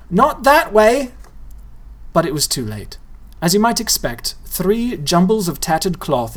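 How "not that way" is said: high, loud, slow warning; urgent warning. A narrator's reading. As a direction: stop her before it's too late. Boy warns girl.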